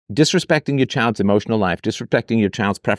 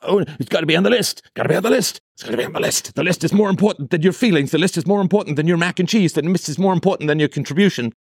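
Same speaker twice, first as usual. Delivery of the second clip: higher, nagging mock voice of a list-obsessed parent; mocking rigid parent; 6 semitones higher, faster than usual. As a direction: parrot a rigid rule-bound parent, whining. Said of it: "anal retentive German engineer".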